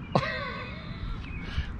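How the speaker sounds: squeaky high laugh; high giggle. Real, not acted. Laughing at hot dog joke.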